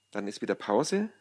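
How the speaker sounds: ordinary delivery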